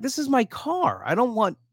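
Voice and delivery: exasperated, without raising the volume; retold indignant objection. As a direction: object in disbelief, pitch well up. Retold scolding of passenger.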